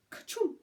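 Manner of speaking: mock sneeze. Imitating a sneeze.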